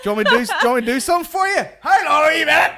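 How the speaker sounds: high, loud, very fast hammed-up offer; mock frantic offer. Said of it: "and be like".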